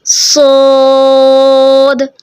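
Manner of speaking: सोद drawn out very slowly, heavy and full in the mouth; heavy saad. Shown as a demonstration. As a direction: hold सोद long with a heavy, resonant sound. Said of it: "मोटी आवाज़ में कौन सा letter अदा करेंगे".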